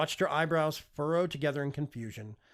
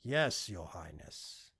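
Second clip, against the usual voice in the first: servant's reply; 6 semitones lower, much slower than usual. A narrator's reading. Nano servant's measured reply.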